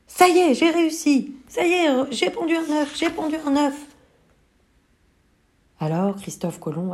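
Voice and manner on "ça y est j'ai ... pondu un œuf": cri de joie très aigu; triomphe. Acted. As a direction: exulter d'avoir réussi. Carmela triomphe. (poulette qui jubile d'avoir pondu, très aiguë)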